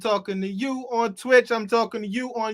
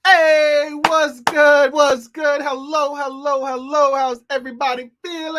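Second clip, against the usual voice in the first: hype greeting; 7 semitones higher, 7 dB louder than usual. Real, not acted. Host greets the crowd on stage.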